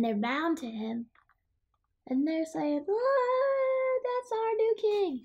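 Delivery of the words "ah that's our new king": high, awed acclaim; adoring monsters. Acted. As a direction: marvel at the new king in a high voice. Monsters hail their new king.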